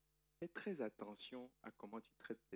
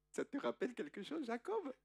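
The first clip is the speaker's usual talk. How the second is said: teasing inner voice; 11 semitones higher than usual. Acted. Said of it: "une petite voix du Saint-Esprit qui dit".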